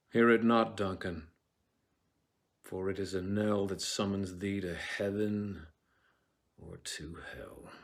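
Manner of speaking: measured verse, sinking away; closing line dropping to a hush; Shakespeare recitation. Acted. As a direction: address the absent king, fading on hell. Soliloquy ends to Duncan.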